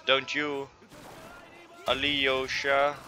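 ordinary talk, nothing marked

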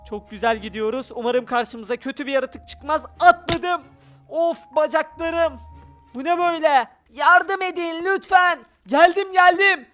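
ordinary talk, nothing marked

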